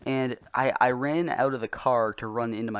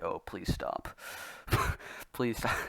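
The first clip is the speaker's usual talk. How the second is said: soft, pained mock pleading; a weak groaning plea to make it stop; suffering plea; 7 dB quieter, slower than usual. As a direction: beg them to stop, barely voiced. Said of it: "I was just suffering".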